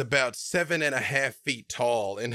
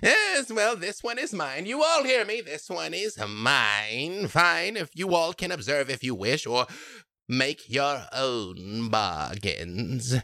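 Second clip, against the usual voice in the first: much higher, shrill claim over her prize; a jealous crone marking her territory; possessive hag; 4 semitones higher than usual. Acted. Hag to the group, higher and possessive. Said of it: "and says".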